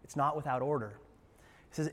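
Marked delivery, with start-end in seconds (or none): none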